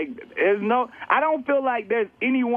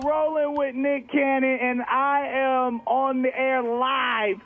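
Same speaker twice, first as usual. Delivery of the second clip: radio host; 7 semitones higher, slower than usual. Acted. Radio host on-air intro.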